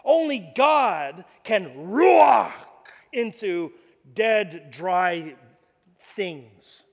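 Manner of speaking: slow, loud, word by word; preaching emphasis. Acted. Preacher drives home the point to the congregation, slow and loud. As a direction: hammer each word of the climax.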